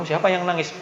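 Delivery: usual speaking voice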